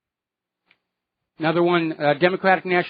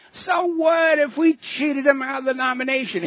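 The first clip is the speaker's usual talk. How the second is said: mocking excuse; 8 semitones higher than usual. Party mouthpiece brushing off the cheated supporters, mockingly. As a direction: parody the cheaters in a raised, put-on voice.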